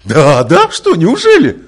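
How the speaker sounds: high, loud sarcastic "неужели?"; mock disbelief. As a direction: mimic a scoffer in disbelief. Mocking imagined objector. (громкая насмешливая интонация недоверия)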